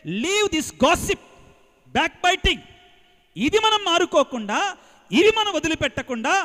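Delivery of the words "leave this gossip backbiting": each word drawn out slowly and loudly; slow emphatic quote. Retold or imitated. Preacher quoting the Pope to the congregation, slow and emphatic. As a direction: lay down the Pope's words slowly, loud and weighty. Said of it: "పాపు గారు మనకు చెప్పేది".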